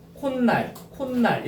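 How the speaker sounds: 콧날 as kon-nal, double n; demonstrated 콧날. Shown as a demonstration. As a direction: lean on the added n in 콧날. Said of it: "이렇게 발음되잖아". ("콘날"로 니은을 살려서)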